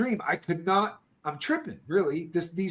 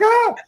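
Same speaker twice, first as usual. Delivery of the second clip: sharp high yelp of alarm; 7 dB louder than usual. Retold or imitated. Yelping at Casper yanking the wire, alarmed. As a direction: yelp as if watching the phone get wrecked.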